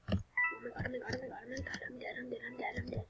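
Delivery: breathless whispered panic; panicked whisper. Real, not acted. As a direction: whisper in panic so the killer won't hear. Hiding from the murderer, panicking.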